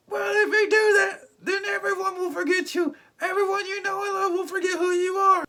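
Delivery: very high, loud put-on voice; mock-dramatic high shouted warning; Doctor Strange. Recap host as wizard warning the hero, high and loud. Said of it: "Doctor Strange's like".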